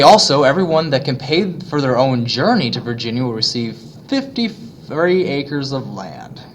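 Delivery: normal talking voice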